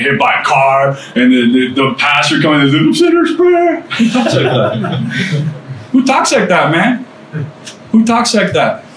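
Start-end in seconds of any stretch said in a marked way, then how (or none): 2.8-3.8 very high, drawn-out mocking squeal; incredulous mockery. Scoffing voice mocks the movie. 3.8-8.8 high-pitched incredulous mockery; scoffing inner voice. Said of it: "You guys have heard that voice too".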